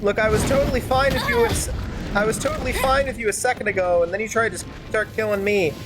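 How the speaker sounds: ordinary delivery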